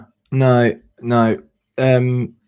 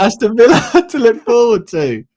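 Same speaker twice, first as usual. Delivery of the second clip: laughing; 6 semitones higher, 7 dB louder, faster than usual. Real, not acted. Laughing through sign-off.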